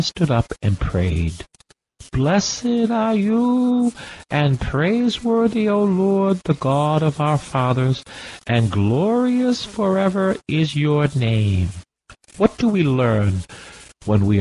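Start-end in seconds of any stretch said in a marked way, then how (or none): 2.1-11.7 high, slow, chanted prayer voice; lightly chanted scripture prayer; intoned prayer. Acted. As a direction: lift it up like a psalm sung in the furnace. Preacher intones Azariah's prayer.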